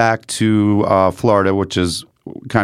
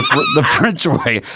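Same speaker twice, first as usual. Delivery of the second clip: slow, higher, laughing disbelief; incredulous; 7 semitones higher, slower than usual. Retold or imitated. To the nurse, slow laughing disbelief. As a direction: stretch it out, baffled and laughing. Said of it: "I'm like".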